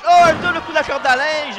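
high loud shout; excited shout. Acted. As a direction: yell the double move. Double clothesline called.

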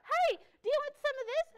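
very high, loud, squeaky cheerleader voice; shrill-high peppy cheer; chirpy Joy. Acted. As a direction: bounce it up like a hyper cartoon pal. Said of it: "joyful person ... says".

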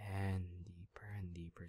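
breathy hypnotic whisper; soft whispered deepening. Real, not acted.

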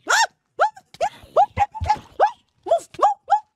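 high shrieking move move move; panicked high-pitched yell; frantic scream. Real, not acted. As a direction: shriek it while running. Fleeing mobs, screaming.

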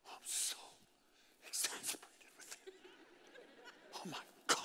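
whispered mock exasperation; an exasperated aside, whispered. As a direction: sigh it out like you're fed up. Acting an exasperated person.

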